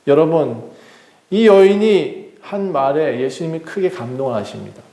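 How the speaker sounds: normal talking voice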